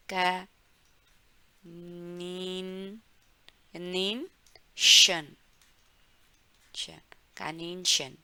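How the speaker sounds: Kaninchen broken into का-, नीन, शन, stretched slowly; syllable by syllable. Shown as a demonstration. Teacher breaks the word into syllables for students. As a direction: split Kaninchen into slow syllables, then join them. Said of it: "कैसे बोलेंगे इसको".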